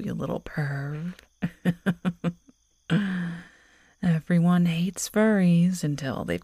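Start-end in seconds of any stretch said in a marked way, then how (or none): 2.9-3.4 short wicked chuckle; teasing laugh. Acted. Werewolf girl mocking her lover, short wicked chuckle. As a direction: laugh at your prey's reaction.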